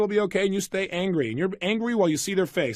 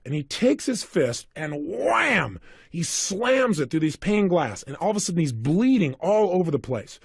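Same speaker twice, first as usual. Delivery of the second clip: ordinary delivery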